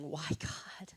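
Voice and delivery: breathy whisper asking why; a hushed plea to God; whispered question. Retold or imitated. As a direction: whisper it like a doubting prayer. Prayer contrasted with trust.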